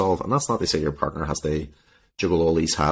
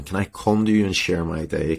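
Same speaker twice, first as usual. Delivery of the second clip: ordinary talk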